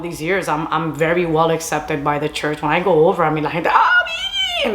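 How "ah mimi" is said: very high, drawn-out squeal; imitated excited greeting. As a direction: squeal the name like a delighted welcome. Church people greeting her excitedly.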